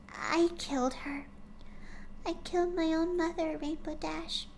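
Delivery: high, soft, hushed pony voice; whispered, sorrowful confession; Fluttershy confession. Acted. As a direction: confess a dark secret quietly. Fluttershy confessing killing mother.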